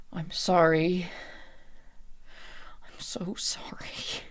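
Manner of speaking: very soft, slow whisper; whispered apology. Acted. Jada to Lou as control slips, slow whispered apology. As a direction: breathe out the apology slowly. Despairing character apologises to friend.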